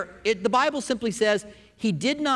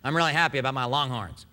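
imitated Maine accent, lower pitched; northeastern US accent; 5 semitones lower than usual. Preacher quoting his friend from Maine, northeastern accent. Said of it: "With his northeastern accent".

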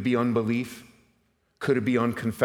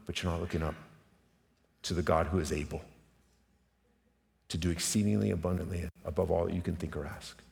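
quiet reflective sermon; 4 semitones lower, 5 dB quieter than usual. Acted.

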